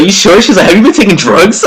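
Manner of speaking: high, loud, laughing alarmed mom; mock panic, voice pushed way up; panicking mom. Mom suspects drugs: high, loud, laughing panic.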